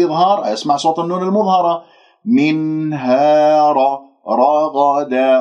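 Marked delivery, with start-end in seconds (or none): 2.2-4.0 منها recited slowly with a clearly sounded n; clear noon. Shown as a demonstration. 4.3-5.4 رغدا slowly, with heavy r and gh. Shown as a demonstration. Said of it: "را مفخمة".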